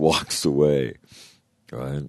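ordinary talk, nothing marked

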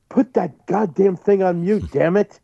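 mock scolding wife. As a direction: play the irritated wife on the plane, slowly. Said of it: "and Lori goes".

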